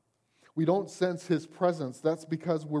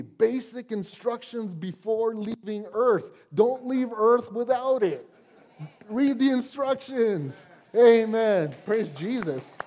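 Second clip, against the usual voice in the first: rising, louder preaching climax, slow; Baptist preacher; 6 semitones higher, 4 dB louder than usual. Real, not acted. Preacher urging the congregation to read the Bible, loud. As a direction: build into a fiery Baptist preacher shout. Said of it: "I feel like a Baptist preacher".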